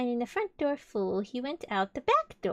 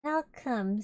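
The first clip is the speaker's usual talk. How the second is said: usual speaking voice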